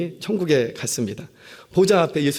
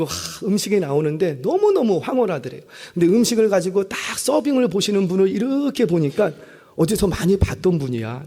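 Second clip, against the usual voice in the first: ordinary talk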